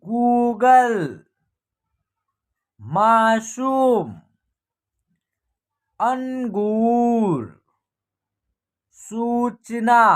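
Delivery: गूगल, मासूम, अंगूर, सूचना read very slowly with long ऊ held; drawn-out pronunciation. Shown as a demonstration. Teacher showing learners long-ऊ words like गूगल, drawn out slowly. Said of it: "इस प्रकार इसका उच्चारण होगा".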